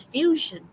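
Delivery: "fusion" said slowly as "FYOO-zhun"; pronunciation demo. Shown as a demonstration. Teacher telling the class how the colour is pronounced, pronunciation demo. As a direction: spell out "fusion" by ear, unhurried. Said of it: "is pronounced as".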